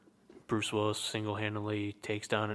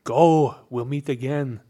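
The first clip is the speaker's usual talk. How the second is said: movie hero line; 4 semitones higher, 12 dB louder, slower than usual. Retold or imitated.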